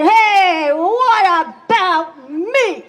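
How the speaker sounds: high, loud cry for attention; indignant call. Acted. Man calling out to the animals, high and loud. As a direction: demand to be noticed, voice up high. Man demands his turn.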